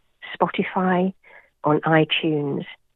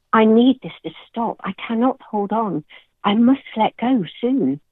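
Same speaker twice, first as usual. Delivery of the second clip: cried out in fear; 6 semitones higher than usual. Acted. Poet voicing herself in the storm, higher fearful cry. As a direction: push the pitch up as if losing your footing. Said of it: "I cried out in fear".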